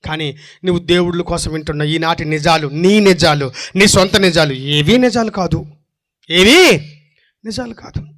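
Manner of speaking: ordinary talk, nothing marked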